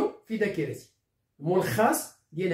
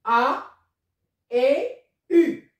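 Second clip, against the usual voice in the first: "A", "et", "U" spaced out, rising and louder to the last; letters called out; 4 semitones higher, 4 dB louder, much slower than usual. Shown as a demonstration. Teacher spelling out the vowels one by one, rising. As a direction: call out each letter, building up. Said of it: "on peut l'écrire sur A et on peut l'écrire sur U".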